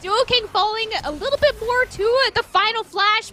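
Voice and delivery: ordinary talk, nothing marked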